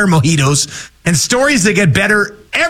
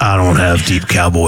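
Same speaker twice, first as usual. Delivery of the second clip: low cowboy voice, Sam Elliott style; deep cowboy voice; 12 semitones lower than usual. Shown as a demonstration. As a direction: drop low like an old Western cowboy. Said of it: "That was pretty good".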